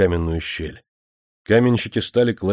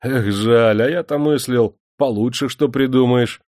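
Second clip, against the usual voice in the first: higher, louder mocking tone; 6 semitones higher, 5 dB louder than usual. A narrator's reading. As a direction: sneer at his cruelty. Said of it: "насмешливо сказал Сенька".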